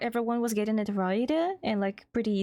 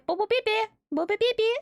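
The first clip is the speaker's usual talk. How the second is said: excited squeal; 12 semitones higher, faster than usual. Real, not acted. Streamer squeals a viewer's nickname in delight. Gleefully chanting a viewer's name.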